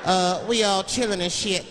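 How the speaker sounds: slow, casual hostage read; mock hostage message. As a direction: read the hostage letter like a shout-out.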